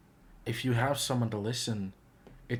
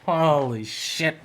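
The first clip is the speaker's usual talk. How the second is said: loud, high drawn-out "oh", falling away; stunned reaction; 5 semitones higher, 10 dB louder, much slower than usual. Real, not acted. Reviewer hit hard by a song lyric, stunned.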